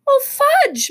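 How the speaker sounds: high, loud cry of dismay; dismayed mother. A narrator's reading. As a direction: exclaim in motherly exasperation. Mother scolds messy birthday boy.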